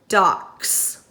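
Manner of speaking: "docks." ending on a sharp S; stressed final S. Shown as a demonstration. Teacher models the word before asking the class. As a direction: hit the S at the end of "docks.". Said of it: "What sound do you hear on the end?".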